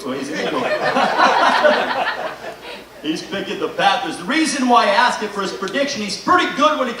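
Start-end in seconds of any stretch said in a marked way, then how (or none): 0.7-2.8 airy laugh. Real, not acted. Laughs at the Eagles joke.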